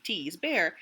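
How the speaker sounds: ordinary delivery, nothing marked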